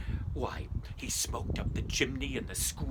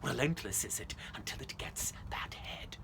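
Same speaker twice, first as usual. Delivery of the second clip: ominous whisper; 4 semitones lower, 7 dB quieter than usual. Acted. Ghost story climax.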